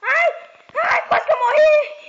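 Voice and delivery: high, loud yelp that breaks into laughter; startled laughing shout. Real, not acted. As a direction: scream with relief and a laugh. Nearly dies in game.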